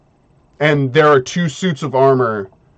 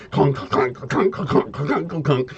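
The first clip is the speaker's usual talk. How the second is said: soft rattling run of metallic clunks; imitated clunking footsteps; 6 dB quieter than usual. Game master voicing two suits walking over, clunking noise. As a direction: patter out hollow armor clanks.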